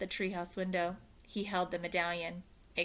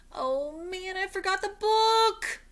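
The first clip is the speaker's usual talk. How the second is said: dismayed; 13 semitones higher, 7 dB louder than usual. Acted. Jack realizes dismay.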